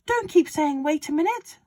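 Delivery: high, loud, gleeful father; excited Mister Cranky. A narrator's reading. Father urging George on about the medicine, high and loud. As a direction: crow it like a man with a scheme. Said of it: "shouted Mister Cranky".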